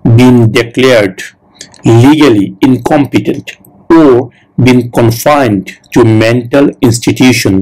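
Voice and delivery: very slow, deliberate question reading; slow, clear interview-question read; slow test question read. Shown as a demonstration. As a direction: read it out word by word for learners. Reads the interview question aloud.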